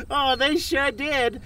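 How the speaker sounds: high, slow words through laughter; laughing. Acted. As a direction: laugh while you talk. Laughs at hanging man.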